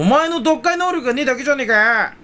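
high, loud shout of irritation; mock angry retort at a commenter. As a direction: snap back at the commenter in mock anger. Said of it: "って思うんですよね".

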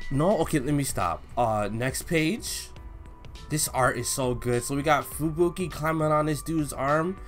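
normal talking voice

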